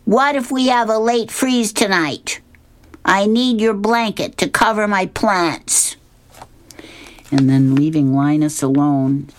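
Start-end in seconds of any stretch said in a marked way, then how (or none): 0.0-6.0 put-on much higher girl's voice; bossy little girl explaining; Lucy in a high voice, matter-of-fact. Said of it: "And Lucy says".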